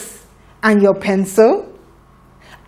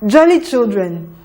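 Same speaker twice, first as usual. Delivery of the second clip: ordinary talk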